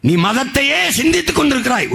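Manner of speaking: high, rising declamation; accusing oratorical cry. Real, not acted. Orator accuses voters obsessed with religion, accusing cry.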